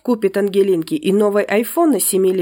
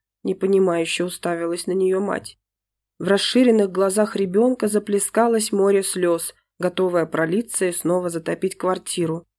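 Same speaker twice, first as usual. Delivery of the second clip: ordinary delivery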